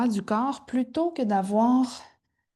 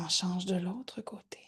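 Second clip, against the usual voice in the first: whispering; 4 semitones lower, 9 dB quieter than usual. Real, not acted. Yoga cue whispered.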